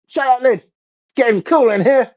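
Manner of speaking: high squeaky complaining ant voice. Pretend. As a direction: whine like a tiny annoyed ant. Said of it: "lift that lid and go".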